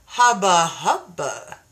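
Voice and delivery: playful ogling sound; hubba-hubba leer. Retold or imitated. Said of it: "And I was like".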